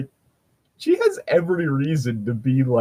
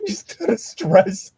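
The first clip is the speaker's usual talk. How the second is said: high, slow, laughing out the words; slower than usual. Real, not acted. Player picturing Ezreal's drinking, laughing. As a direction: wheeze it through laughter.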